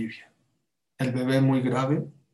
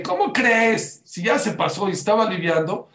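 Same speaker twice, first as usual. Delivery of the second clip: incredulous; 6 semitones higher, 5 dB louder than usual. Retold or imitated. Retold incredulous reply.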